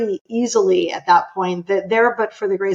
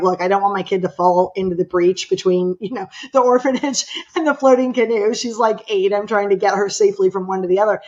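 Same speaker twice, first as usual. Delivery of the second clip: usual speaking voice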